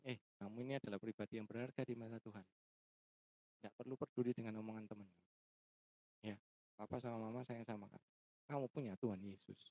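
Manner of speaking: put-on soft, tender reassurance to a crying child; softly comforting his tearful daughter; gentle comfort. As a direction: soothe her quietly, like a father holding his daughter.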